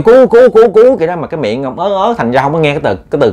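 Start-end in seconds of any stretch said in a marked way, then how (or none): 0.0-1.0 high, loud desperate calls for help; cry for help. Retold or imitated. Uncle trying to call the neighbor for help, loud and high. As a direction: shout for help with no breath. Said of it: "định ráng ông kêu".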